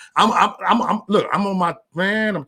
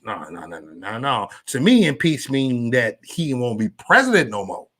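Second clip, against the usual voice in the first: normal talking voice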